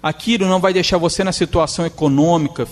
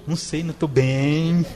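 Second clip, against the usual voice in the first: mock whiny complaint; slower than usual. Woman who always complains, answering mopey and slow. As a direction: drag it out like a lament.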